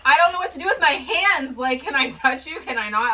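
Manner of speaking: re-enacted nervous outburst; retold flustered nerves. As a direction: blurt it out, starstruck and awkward. Retold flustered meeting with band.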